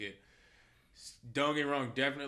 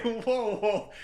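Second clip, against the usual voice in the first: high, loud comic shock; hammed-up, high-pitched astonishment; stunned disbelief; 13 semitones higher, 10 dB louder than usual. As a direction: react as if floored, voice shooting up. Acting out his shock at the hook.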